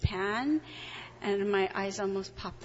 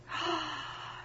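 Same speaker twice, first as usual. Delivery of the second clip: sharp startled gasp; 6 semitones higher, 7 dB quieter than usual. Real, not acted. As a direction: gasp in shock. Realizing who it was.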